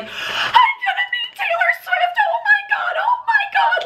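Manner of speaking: very high squealing scream, reenacted; freaking-out scream. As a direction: act out losing it with drawn-out, sky-high shrieks. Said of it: "like, freaking out".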